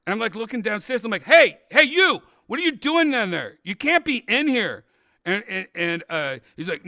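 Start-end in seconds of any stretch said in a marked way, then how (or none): 1.2-4.8 shouted hey down through the floor; loud challenge to the intruder; calling out. Retold or imitated. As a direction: yell down at the stranger below. Yelling at intruder under floor.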